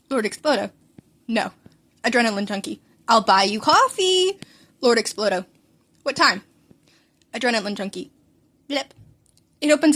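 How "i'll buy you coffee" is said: high, slow coaxing offer; sing-song bribe; sweet bribe. Acted. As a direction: coax him with a bribe, drawn out. Bribing friend to come.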